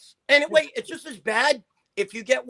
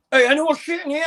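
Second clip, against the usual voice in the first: retold boxer swagger; 8 semitones higher, 6 dB louder than usual. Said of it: "He said".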